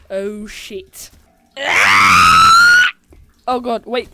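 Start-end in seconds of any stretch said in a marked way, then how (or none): 1.6-2.9 piercing shriek of terror, very high; real high terrified scream. Real, not acted. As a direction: scream your lungs out in fright. Monster attacks in horror game.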